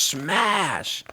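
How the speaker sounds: usual speaking voice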